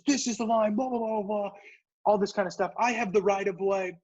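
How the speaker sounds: high put-on woman's voice insisting; imitating the angry lady. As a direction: mimic her lecturing you about the line. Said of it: "and she goes".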